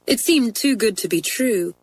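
plain reading voice

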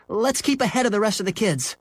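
high-pitched urging call; yelling. Acted. Henry urging his dog away from school, high yell. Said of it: "Henry yelled".

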